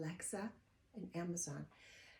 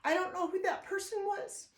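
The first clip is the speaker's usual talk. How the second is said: real emotion cracking the voice, pitched higher; choking up on the words; voice breaking; 8 semitones higher, 3 dB quieter than usual. Real, not acted. Vlogger choking up remembering her old photo, voice breaking. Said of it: "That's how emotional I get".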